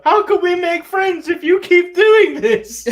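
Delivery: high, slow whiny imitation of Riddle; Riddle impression. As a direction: mimic a plaintive high pleading voice. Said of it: "Riddle hit him with the".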